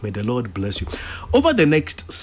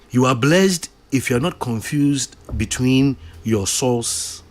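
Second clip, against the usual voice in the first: ordinary delivery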